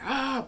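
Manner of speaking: high, loud mock cries of pain; imitating injured boy yelling. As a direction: cry out as if hurt.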